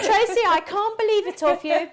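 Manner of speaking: high, laughing mock reproach; mock shocked laughter. Real, not acted. Host teasing the guest Tracy, mock shocked laughter. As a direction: tease her through a laugh.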